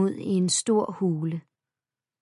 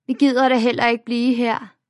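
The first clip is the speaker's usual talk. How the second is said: haughty; 5 semitones higher, 6 dB louder than usual. A narrator's reading. Bully sniffs haughtily.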